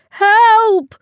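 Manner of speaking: loud, very high yell; cry for help. A narrator's reading. Customer carried off by tea lady, crying for help. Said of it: "cried the man".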